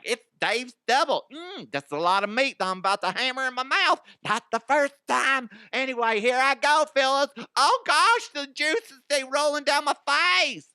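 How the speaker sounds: high, loud, excited mock reviewer; hammed-up food reviewer. Auditioning as a Wendy's taste tester, hammed-up high excited voice. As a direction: gush over the burger at full pitch. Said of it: "Be like".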